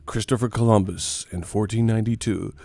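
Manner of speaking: usual speaking voice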